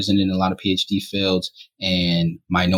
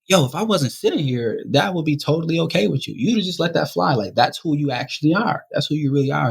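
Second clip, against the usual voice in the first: heated confrontation; 8 semitones higher than usual. Retold or imitated. Confronting the boy.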